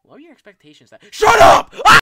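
high, loud, building to a scream; shouting. Pretend. Shouting back at Mom.

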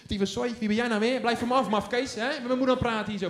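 put-on a boy yelling at the strange teacher to back off; cursing child. Child curses at teacher Rini. (kind scheldt luid tegen juf Rini)

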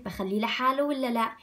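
normal talking voice

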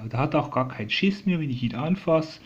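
usual speaking voice

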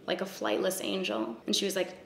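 ordinary delivery

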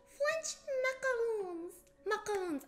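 "French macaroons" in a high, attempted French accent; "macaroons" as a clumsy attempt at French; imperfect French pronunciation. Shown as a demonstration. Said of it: "I can't even say it properly".

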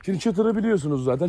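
ordinary talk, nothing marked

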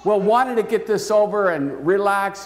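usual speaking voice

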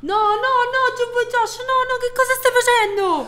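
no ripetuti, molto più alti; supplica spaventata. Acted. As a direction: implora di fermarsi. Implora Jumbo Josh. (supplica acuta e spaventata)

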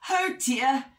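high-pitched fretting exclamation; fussy storybook bear; fussing. A narrator's reading. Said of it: "fussed Medium Bear".

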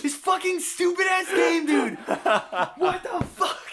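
high, loud frustrated rant; angry. Real, not acted. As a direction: rage at the game. Raging at game after dying.

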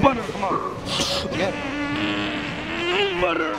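high-pitched hype call; hype cheering. Real, not acted. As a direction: cheer a buddy on mid-lift. Hyping up a lifting partner.

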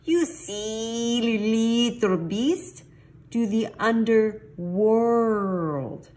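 high, drawn-out, hammed-up scolding; exasperated correction. A narrator's reading. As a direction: stretch each word to correct the silly kitty. Pups correct the silly kitty.

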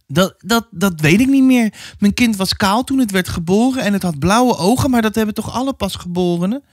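high, faltering, defensive voice; flustered mother. Acted. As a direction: stumble into an excuse, pitched high. (onzekere moeder, hoog en verdedigend)

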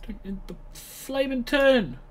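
panicked, breathless rush; gasping out words in a panic; hyperventilating. Real, not acted. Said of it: "sorry, panicking".

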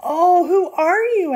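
high, loud surprised exclamation; startled question. A narrator's reading. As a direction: ask in high-pitched astonishment.

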